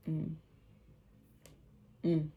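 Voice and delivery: normal talking voice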